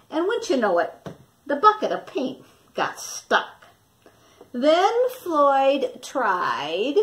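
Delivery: put-on lively storybook narration for young listeners; reading to children. Teacher reading Stuck to her class, lively storytelling voice. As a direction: tell it like a picture book to a class. Teacher reads picture book aloud.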